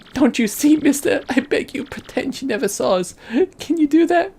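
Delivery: put-on high-pitched girlish plea; begging daughter. Daughter begs stranger to pretend he never saw them, high pleading. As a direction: beg the stranger in falsetto.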